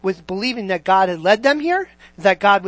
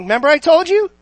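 ordinary talk, nothing marked

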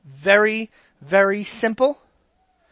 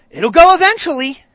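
mock insistence; 8 semitones higher, 9 dB louder than usual. Forcing the wrong shape in.

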